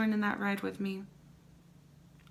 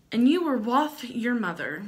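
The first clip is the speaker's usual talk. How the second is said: dramatic reading; 4 semitones higher, 6 dB louder than usual. Acted. Reading ad aloud, louder.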